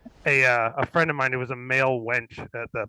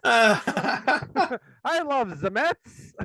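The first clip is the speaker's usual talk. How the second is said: put-on Swedish accent faking American, pitched higher; hammed-up Swede pretending to be an American teen; mock Swedish-accented American kid, slow at the end; Swedes playing Americans; 8 semitones higher, slower than usual. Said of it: "all the actors are obviously Swedish".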